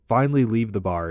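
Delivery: ordinary talk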